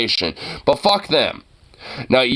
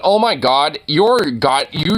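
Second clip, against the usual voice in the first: ordinary delivery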